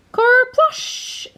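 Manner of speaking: high, loud splashy kerplush; splash sound. A narrator's reading. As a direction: pop it like a splash.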